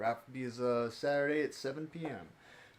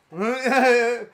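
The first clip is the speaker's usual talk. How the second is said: loud, high cackle; 8 semitones higher, 13 dB louder than usual. Pretend. Streamer relishing a favourite scene, with a loud cackle. Cackling after villagers joke.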